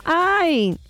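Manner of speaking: distant echoing "¡Ay!" thrown back from the mountain; mock mountain echo, high and far off; the mountain answering the boy's cry. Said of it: "una voz repitió".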